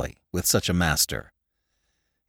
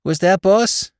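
high, louder rising question in dialect; Spike, baffled crook; 9 semitones higher, 6 dB louder, slower than usual. A narrator's reading. Spike asking his glaring boss, baffled crook voice. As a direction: innocent New York sidekick query.

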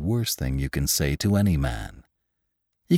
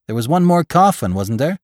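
high, louder questioning; puzzled gravedigger; 9 semitones higher, 4 dB louder than usual. A narrator's reading. Laborer asking Jim about the last coffin, puzzled. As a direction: seek confirmation with the pitch well up.

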